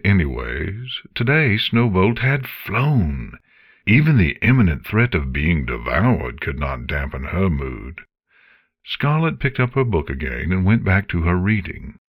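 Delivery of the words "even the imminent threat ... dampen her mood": usual speaking voice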